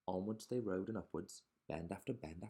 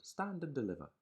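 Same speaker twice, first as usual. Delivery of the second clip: loud, higher highwayman command; thundered; 8 semitones higher, 4 dB louder than usual. Acted. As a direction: boom it out like a robber. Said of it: "who thundered".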